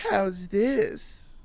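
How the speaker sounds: high, put-on campy drawl done badly; a deliberately poor take on a famous drag host; bad Lady Bunny impression. Teasing his co-host with a bad Lady Bunny voice. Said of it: "that is a horrible Lady Bunny impression".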